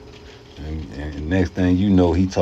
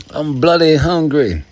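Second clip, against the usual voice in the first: higher, louder, slow mock British complaint; mock British accent; 10 semitones higher, 6 dB louder than usual. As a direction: lean on bloody like a caricatured Londoner. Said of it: "mock Londoner continues".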